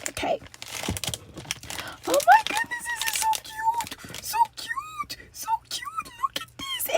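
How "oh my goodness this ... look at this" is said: squealing high over something cute; high cooing. Real, not acted. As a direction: coo over it like a tiny treasure.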